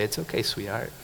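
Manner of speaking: put-on soft, hushed comfort to a baby; soothing dad. Father hushing his crying baby daughter, soft and tender. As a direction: lightly soothe a crying child. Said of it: "I pat her belly and I say".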